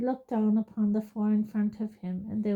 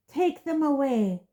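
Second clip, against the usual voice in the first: higher, louder furious roar; 6 semitones higher, 4 dB louder than usual. A narrator's reading. Crouch roaring at the Dementors, loud furious. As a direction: roar the order. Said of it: "Crouch roared at the Dementors".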